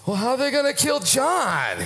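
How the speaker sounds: high, playful Peter voice; high-pitched mock Peter, half laughing; Peter's cheeky reply. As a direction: toss it off like a cheeky fisherman. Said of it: "You remember Peter's response".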